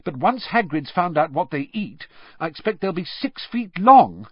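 exasperated, rising to loud and high; fed-up warning, voice lifted. A narrator's reading. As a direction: argue in exasperation, building at the end. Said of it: "in an exasperated voice".

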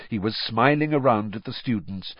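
plain reading voice

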